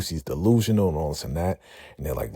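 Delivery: ordinary delivery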